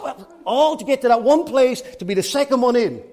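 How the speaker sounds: ordinary talk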